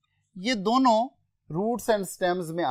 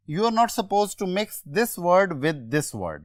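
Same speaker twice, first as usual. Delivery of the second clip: ordinary talk, nothing marked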